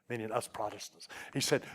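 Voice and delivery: normal talking voice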